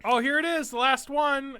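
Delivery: high, bright fake discovery; feigned surprise. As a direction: act like you just found it.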